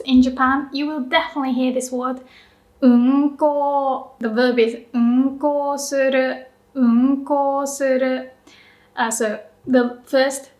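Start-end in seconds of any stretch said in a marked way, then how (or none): 2.8-4.1 unko with low un, higher drawn-out ko; low-high pitch accent. Shown as a demonstration. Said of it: "is low and the rest is high". 4.9-8.3 unko suru slowly, low un then high; slow demo of unko suru, low start, high rest; low-high pitch accent. Shown as a demonstration. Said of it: "is low and the rest is high".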